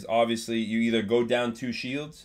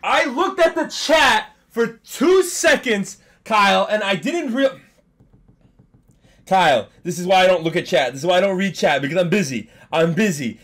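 exasperated yelling; 7 semitones higher, 9 dB louder than usual. Real, not acted. Streamer yelling at his friend Kyle for distracting him, exasperated. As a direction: rant at him, louder and louder.

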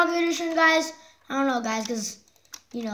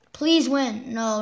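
normal talking voice

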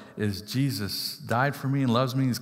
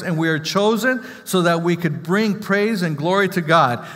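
rising, louder preacher's build; preaching at full force; 4 semitones higher, 5 dB louder than usual. Acted. Preacher rousing his congregation, loud and rising. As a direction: drive the message home to the congregation.

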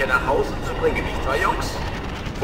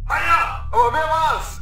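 excited; 7 semitones higher, 3 dB louder than usual. Acted. Excited question after the victory.

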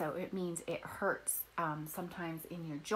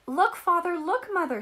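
much higher and louder, bursting with news; excited cry; 10 semitones higher, 8 dB louder than usual. A narrator's reading. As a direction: call out to the parents in delight, high and loud. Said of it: "she cried".

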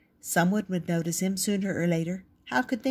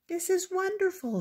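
much higher, sing-song lady's voice; delighted woman; 13 semitones higher than usual. A narrator's reading. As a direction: gush it like a thrilled woman. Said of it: "she said".

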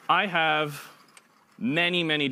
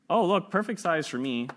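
pleased shark; 7 semitones higher than usual. Pretend. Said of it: "This middle shark goes".

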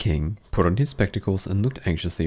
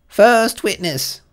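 high, loud, slow herald's call; announcing the witness to the court; 13 semitones higher, 7 dB louder, much slower than usual. A narrator's reading. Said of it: "called out".

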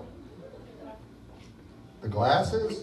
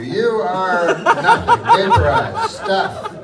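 much higher and louder, slow on the first words; excited comic outburst; 11 semitones higher, 7 dB louder, much slower than usual. Acted. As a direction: blow it up into a gleeful punchline. Comic build on plane crash.

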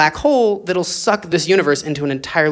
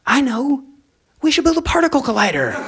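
higher, quick, pleased with the idea; eager fish; 8 semitones higher than usual. Acted. Fish proudly proposing a collider, eager and quick. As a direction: pitch up and rush the bright idea. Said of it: "fish decides to build collider".

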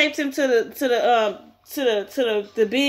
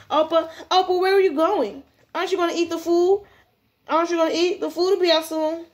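put-on high girlish voice calling after him; higher-pitched, whiny schoolgirl; clingy girl, protesting; 5 semitones higher than usual. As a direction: plead with him as he walks off.

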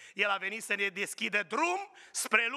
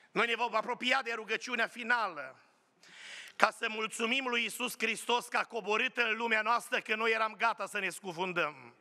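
ordinary talk

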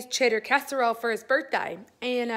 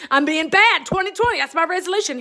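louder and higher, proudly declaring it; defiant proclamation; 4 semitones higher, 6 dB louder than usual. Retold or imitated. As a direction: announce your naughty resolution proudly. Defiant reply to nephew.

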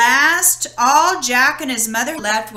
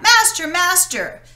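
much higher alarmed call; cry for help; 7 semitones higher, 4 dB louder than usual. Acted. Golden harp calling to the sleeping giant, alarmed cry. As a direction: wake your master, quick. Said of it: "The harp cried out".

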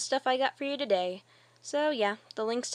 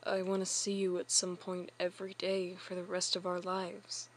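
ordinary delivery

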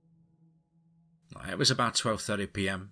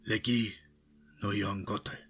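starts raised, settles as it ends; calling out; 2 semitones higher than usual. A narrator's reading. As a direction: call a command in Hungarian. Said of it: "a voice called out".

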